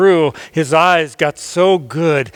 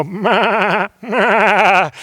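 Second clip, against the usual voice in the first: 1 bleating sheep. A narrator's reading. Narrator imitates the sheep. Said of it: "those sheep would go".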